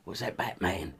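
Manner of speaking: soft, low Cockney prompt. Shown as a demonstration. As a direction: murmur it in a Cockney accent. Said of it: "Cockney, oh, East, East End".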